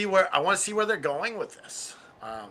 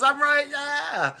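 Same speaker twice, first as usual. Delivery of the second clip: smug gloating; 10 semitones higher, 4 dB louder, much slower than usual. Retold or imitated. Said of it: "But the Swerve was".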